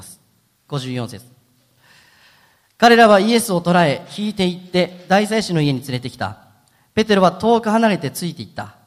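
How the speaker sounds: measured, solemn reading of the Bible passage; scripture reading. Acted. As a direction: read the Gospel text steadily to the congregation. Reads scripture aloud.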